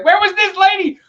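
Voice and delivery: very high, loud mock disbelief; hammed-up squealing bafflement; bewildered outburst, retold. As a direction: sputter it high in utter confusion. Retold bewilderment at mom's switch.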